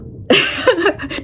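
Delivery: amused little laugh; short laugh. Real, not acted. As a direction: laugh at the punchline. Laughs at her own punchline.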